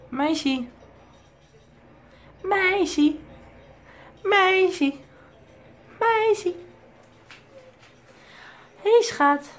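very high sing-song calling with falling ends. Real, not acted. Owner calling his parakeet Meisje, high coaxing sing-song. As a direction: coo the bird's name up high to win its attention.

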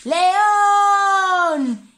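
grito agudo y alargado; llámalo a voces con los niños; llamada a gritos. Acted. Llamando al león. (los animales llaman al león a gritos)

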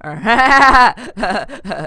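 loud hammed-up fake laughter; mock a YouTuber forcing a laugh; over-the-top fake ha ha ha. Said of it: "they always fake laugh".

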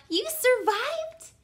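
high, slow, astonished question; amazed cry. A narrator's reading. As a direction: stretch it out in disbelief. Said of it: "cried Stella Luna".

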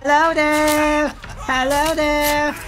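slow, high, loud mocking greeting; taunting. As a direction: draw it out to taunt the prey. Taunting the cornered victim.

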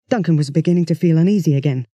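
usual speaking voice

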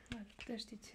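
ordinary talk, nothing marked

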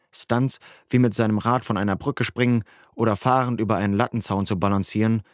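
ordinary delivery, nothing marked

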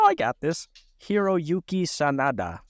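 Hiroyuki Sanada said slowly and carefully, syllable by syllable; proud careful try at a Japanese name; careful pronunciation. Shown as a demonstration. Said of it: "let's hear you pronounce it".

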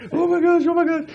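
high-pitched panicked repetition; imitated freaking out. Imitating his wife in the tunnel, freaking out. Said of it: "she's just freaking out".

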